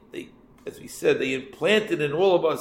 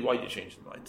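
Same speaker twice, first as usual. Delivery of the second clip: much softer, hesitant and shy; timid question; 4 semitones lower, 11 dB quieter than usual. Retold or imitated. Widow shyly asks the brother why, timidly. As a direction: ask it as if afraid of the answer. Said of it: "She asked him timidly".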